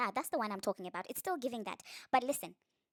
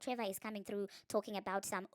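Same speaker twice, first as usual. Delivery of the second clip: ordinary talk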